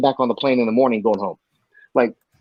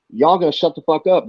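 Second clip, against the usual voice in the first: ordinary talk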